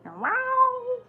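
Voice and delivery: high, strained cat yowl imitation. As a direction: mimic a cat yelling at night. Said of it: "you're gonna yell all night".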